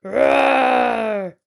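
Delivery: big, loud roaring shout; bear roar. Acted. Bear roaring as she tugs his fur. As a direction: let out a full bear roar. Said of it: "Old Bear roared".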